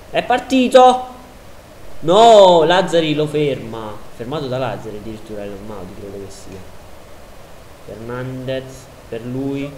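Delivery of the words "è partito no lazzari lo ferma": excited commentary. Real, not acted. Striker breaking through.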